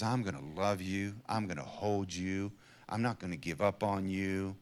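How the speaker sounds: tender promise. Pretend. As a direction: promise it softly, holding on. Voicing committed love.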